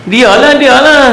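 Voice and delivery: high, loud, slow finger-pointing cry; mock accusing cry. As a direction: blame someone else, loudly and high. Accusing others on judgment day. (menuding dengan suara tinggi, lantang dan perlahan)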